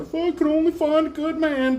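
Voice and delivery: high mocking woman's voice; high mock whiny woman; mocking women. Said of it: "hearing women talking about".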